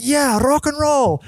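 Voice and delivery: rock and roll with a rolled R on "rock". Real, not acted. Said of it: "I don't know why I rolled my R there".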